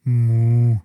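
loud, flat cow answer; a quick no in cow; short moo. Acted. Cow answers girl's question.

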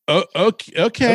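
put-on a cornered nineteen-year-old giving in; hesitant okay. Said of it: "I was like, um".

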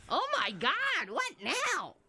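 drawn-out, high-pitched mock exasperation; sarcastic. Co-host reacting to the host's new stuff, sarcastic. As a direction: exclaim it slowly and high, dripping sarcasm. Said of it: "sarcasm hit".

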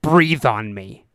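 slow, low-key command, words drawn out; measured cop order. Retold or imitated. Cop's first order, later repeated louder.

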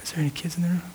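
soft unvoiced whisper of God's voice; whispered inner voice. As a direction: breathe it like a voice inside your spirit. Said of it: "the voice of God in my spirit was like".

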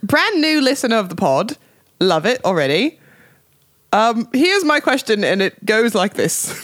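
put-on reading aloud in a posh British accent; hammed-up posh British accent. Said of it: "read it with a British accent".

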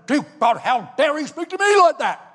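loud-ending, high-rising mock outrage; retold indignation. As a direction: storm out protesting to God. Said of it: "I stormed out".

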